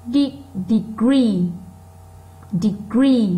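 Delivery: "degree" with GREE punched and held; stressed second syllable. Shown as a demonstration. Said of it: "nó to hơn những âm còn lại". (nhấn mạnh âm hai của "degree")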